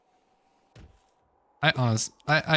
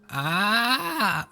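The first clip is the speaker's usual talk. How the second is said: put-on raised old man sigh of recognition; high old man "ah"; 10 semitones higher than usual.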